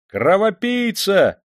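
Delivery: high, loud, drawn-out cries; crowd shouting. A narrator's reading. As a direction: cry out for punishment from the crowd. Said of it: "закричали в толпе". (гневные крики толпы)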